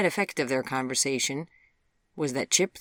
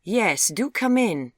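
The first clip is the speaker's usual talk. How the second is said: slow, loud, higher invitation; mother's pointed echo; 7 semitones higher, 9 dB louder, slower than usual. A narrator's reading. Mother echoing her daughter's invitation to the caller, pointed. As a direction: draw out the invitation commandingly. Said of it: "echoed her mother".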